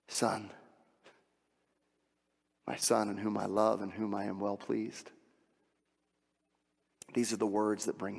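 hushed reassuring pastoral voice; tender ministry. Acted. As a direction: speak healing quietly to the hurting. Soft closing ministry moment.

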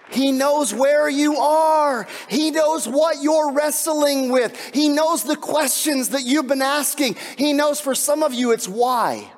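fervent preaching. Acted. Pastor assuring grieving listeners that He knows, slow fervent cry. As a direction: stretch each word out in a rising plea.

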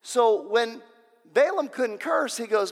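normal talking voice

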